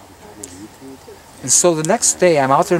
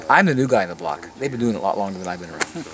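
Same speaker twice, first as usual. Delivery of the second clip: usual speaking voice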